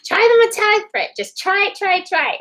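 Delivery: high-pitched mock urging friend; pushy friend urging. Friend pushing her to try.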